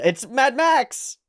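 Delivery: loud, high, drawn-out realization; excited exclamation. Real, not acted. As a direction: call out the discovery loudly. Sudden recognition of another film.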